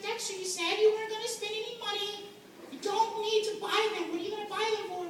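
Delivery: high-pitched nagging girlfriend impression. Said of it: "She's like".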